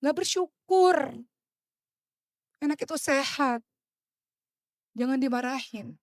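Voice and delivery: preacher reproaching ungrateful parents, high and slow. Real, not acted. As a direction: scold them for ingratitude.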